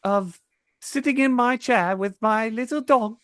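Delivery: put-on prim British accent, royal and proper; British Deku. Deku playing the Queen of England, British accent. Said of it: "Deku, but British".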